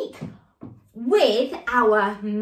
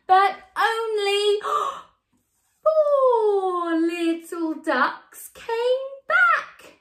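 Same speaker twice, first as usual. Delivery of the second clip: sad; 7 semitones higher, much slower than usual. Acted. Sad turn in the song.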